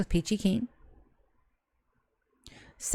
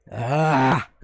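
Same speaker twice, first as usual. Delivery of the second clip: ordinary delivery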